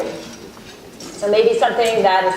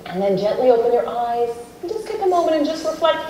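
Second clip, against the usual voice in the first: guided meditation. Acted. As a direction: ease the room gently inward, unhurried. Leads a self-compassion meditation.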